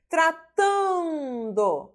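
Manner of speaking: "Tratando" with a very long, high TAN; stressed syllable demonstration. Shown as a demonstration. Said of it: "paroxítona".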